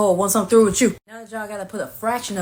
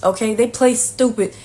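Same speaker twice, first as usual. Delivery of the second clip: ordinary talk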